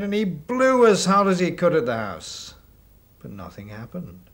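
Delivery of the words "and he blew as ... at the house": loud, effortful. Acted. Storyteller acts out wolf blowing.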